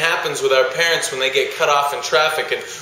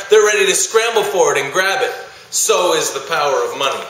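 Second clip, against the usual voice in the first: ordinary talk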